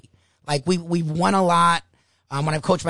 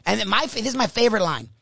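ordinary talk, nothing marked

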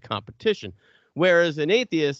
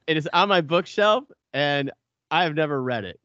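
ordinary delivery

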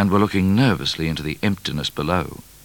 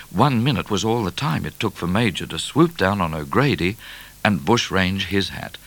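ordinary delivery, nothing marked

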